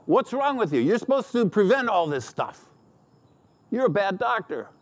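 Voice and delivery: a patient shouting at his doctor; mock yelling. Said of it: "he yells at the doctor".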